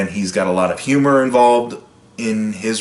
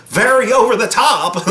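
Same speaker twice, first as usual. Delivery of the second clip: hammed-up American accent, much higher; a British actor's exaggerated American accent, pitched way up; 12 semitones higher, 3 dB louder than usual. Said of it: "just a very exaggerated".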